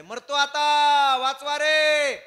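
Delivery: high, very loud drawn-out shout; acted desperate bellow for rescue; distant cry for help. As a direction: yell from far away as if dying. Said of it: "ते तिकडून ओरडायले".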